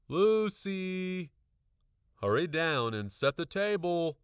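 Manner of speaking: high, loud call from below; dad calling his daughter down for dinner; calling upstairs. A narrator's reading. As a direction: call it up the stairs. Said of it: "my dad called upstairs".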